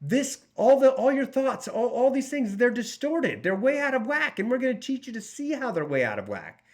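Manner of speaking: a hint of a cheerful counsellor; mock therapist voice. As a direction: lightly play an upbeat therapist explaining. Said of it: "They're like".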